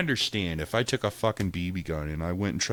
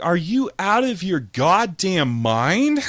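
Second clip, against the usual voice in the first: slow outraged yell; 8 semitones higher, 5 dB louder, slower than usual. Retold or imitated. Reacting to six months jail.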